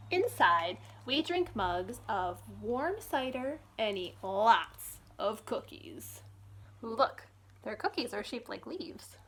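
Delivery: bright, lively storybook reading; reading to children. Acted. Librarian reading a picture book to children, lively storytime voice. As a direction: read it like storytime for little ones.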